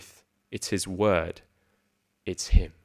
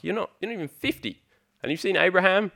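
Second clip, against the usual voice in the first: high-pitched, disbelieving quote; scoffing disbelief in a raised, higher voice; mock incredulous; 9 semitones higher, faster than usual. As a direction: act out an indignant, incredulous objector, pitched way up. Acting out outraged crowd's reply.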